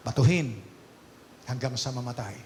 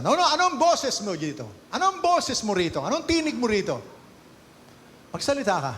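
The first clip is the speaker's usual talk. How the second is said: higher, insistent demanding questions; pressing accusers; 8 semitones higher, 3 dB louder than usual. Acted. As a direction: keep pushing him to answer. Accusers pressing Jesus to speak.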